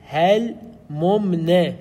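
هل ممن split slowly into "hel-mum-ne"; iki mim ayrı. Shown as a demonstration. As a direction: sound both meems in هل ممن, syllable by syllable. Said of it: "يجب أن تقول".

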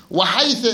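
"وحيث" ending in a short a, wa-haytha; fatha ending. Shown as a demonstration. Teacher shows students the second allowed vowel, fatha ending on "وحيث". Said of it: "بالفتح".

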